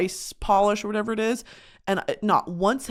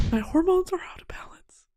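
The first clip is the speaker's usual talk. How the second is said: hoarse whisper; 8 semitones higher, 4 dB quieter than usual. Shown as a demonstration. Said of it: "repeats it whispering".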